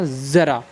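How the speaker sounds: ज़रा said as zara with a z; ज़रा with the Urdu z sound; z in ज़रा, not j; nukta pronunciation. Shown as a demonstration. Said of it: "जैसे क्या?".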